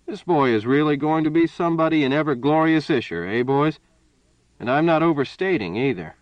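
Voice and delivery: loud, high, hearty boast. A narrator's reading. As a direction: boom it out heartily.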